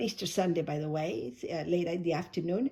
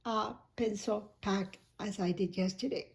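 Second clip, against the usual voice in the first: ordinary talk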